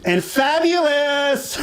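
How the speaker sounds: slow, high, flamboyant "fabulous"; camp drawn-out fabulous. Pretend. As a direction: stretch "fabulous" out, high and campy. Mocking show name.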